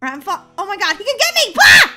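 frightened high yelp; scared horror game streamer. Real, not acted. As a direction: shriek as he lunges. Caught by chaser.

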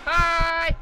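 high shouted hi; loud greeting. Real, not acted. Shouts at monster.

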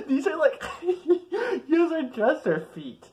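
high, loud words through giggles; can't stop laughing, squeal it; laughing fit. Real, not acted. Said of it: "I can't stop laughing".

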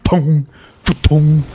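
slow, drawn-out booming sound effect; imitated weapon booms. Player mimicking a meaty weapon, imitated booms. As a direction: voice the heavy hits yourself.

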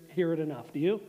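ordinary talk, nothing marked

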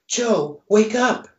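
high hoarse plea as a Marilyn-style creature. Acted. Creature begs sleeping Joe to wake up, hoarse and high. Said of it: "the creature says in a hoarse voice".

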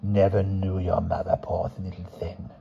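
put-on quiet old crone; old woman voice.

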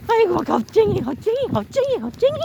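high playful taunting; teasing. Real, not acted. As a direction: tease the dog in rising sing-song. Teasing scared dog.

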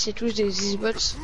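ordinary talk

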